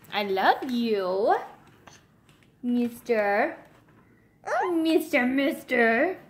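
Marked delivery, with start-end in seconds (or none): none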